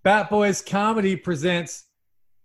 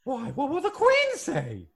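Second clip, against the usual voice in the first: prim lady voice; 8 semitones higher, 4 dB quieter, slower than usual. Acted. [name] in British lady voice.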